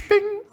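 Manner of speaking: very high sung bing like a bell; bing chime. Pretend. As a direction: mimic a ding sound. Imitating a ding sound.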